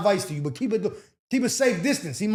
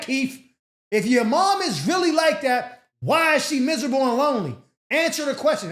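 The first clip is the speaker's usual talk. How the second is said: higher and louder, slowed, hammered accusation; mock shouting rant; 6 semitones higher, 6 dB louder than usual. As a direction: yell it like a furious partner demanding answers. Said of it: "that's how I read it in my mind".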